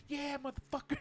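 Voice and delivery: mock girlfriend voice. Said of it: "she's like".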